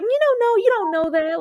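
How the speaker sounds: high-pitched incredulous protest; squeaky-high pushback; mock protest. Acts out reply to Shauna.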